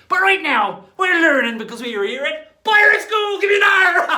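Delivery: loud, high shouted rally; booming pirate cheer; rousing shout. Acted. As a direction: pump up the class.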